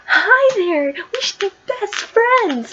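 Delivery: high, loud, sing-song greeting, drawn out slow; bubbly Harley voice. Retold or imitated. Harley greeting Poison Ivy at gunpoint, high giddy gushing voice. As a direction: gush like a giddy new best friend. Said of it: "Harley's just like".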